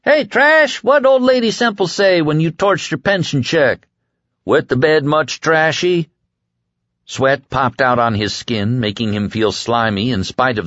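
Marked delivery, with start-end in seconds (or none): 0.0-6.1 high jeering schoolkid taunt; sing-song bully taunting; mocking taunt. Kids taunting Trash.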